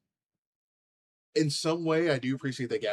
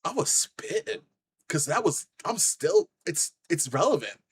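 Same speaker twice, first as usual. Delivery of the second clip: heated, higher and louder rant; fired up; 8 semitones higher, 5 dB louder than usual. Real, not acted. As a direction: let the anger boil over. Heated rant about abuser.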